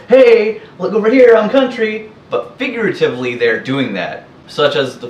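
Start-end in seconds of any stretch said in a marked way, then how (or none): 0.1-2.1 higher, louder hammed-up bragging call; mocking show-off. Imitating country posers calling for attention, mock loud show-off. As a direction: caricature someone begging for attention. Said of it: "I haven't yet seen anyone literally go".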